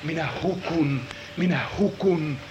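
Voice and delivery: low, soft broken lament; despairing lament. Acted. Prophet despairing over his people, low lament. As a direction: grieve it quietly. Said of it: "Saarnaaja itse tuntee".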